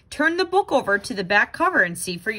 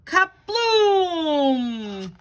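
big kaboom; 5 semitones higher than usual. Acted. Machine explodes.

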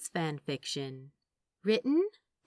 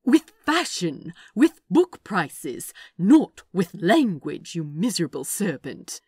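mild exasperated scolding; 10 dB louder than usual. Acted. Angel scolding the demon about language, mildly exasperated. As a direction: scold him with fussy exasperation.

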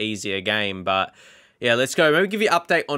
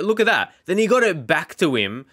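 much higher, a bit louder look-at-that; excited; 6 semitones higher, 3 dB louder than usual. Real, not acted. Replaying his player's strike for viewers, excited. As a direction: point the replay out in delight.

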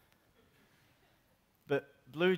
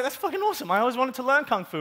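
high, loud burst of enthusiasm; excited Jesus; 9 semitones higher, 7 dB louder than usual. Acted. Jesus learning that monks do Kung Fu, excited. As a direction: gush with delight, pitched up. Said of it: "Jesus would be like".

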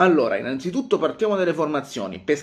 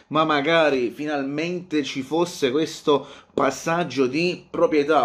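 usual speaking voice

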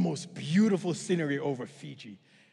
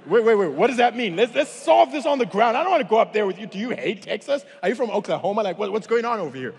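loud, high-pitched comic panic; hammed-up nervous objection; alarmed protest; 5 semitones higher, 7 dB louder than usual. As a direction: protest loudly as if backing out of the jump. Acted panicked protest to instructor.

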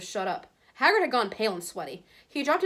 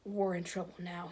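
hushed dismay; 9 dB quieter than usual. A narrator's reading. Ron gloomy after the arrest.

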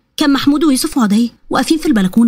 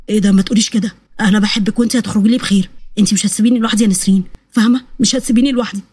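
low-pitched, earnest husband voice; husband insisting; 4 semitones lower, faster than usual. Acted. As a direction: refuse her farewell, low and firm.

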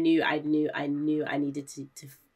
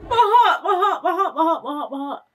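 panicked laughing; 11 semitones higher, 9 dB louder than usual. Real, not acted. Panic after fright.

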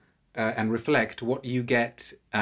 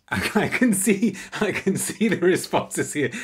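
laughing, higher and louder, delighted; 8 semitones higher, 6 dB louder than usual. Real, not acted. Reviewer laughing as he reads live comments, delighted. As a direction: laugh through the words at the viewers' replies.

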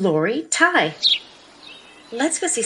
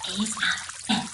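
hushed, secretive whisper; storyteller's conspiratorial whisper; 6 dB quieter than usual. Acted.

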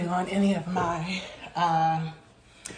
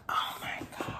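awed whisper; 9 dB quieter than usual. Real, not acted. Touched by a friend's gift, awed whisper. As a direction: whisper it in awe.

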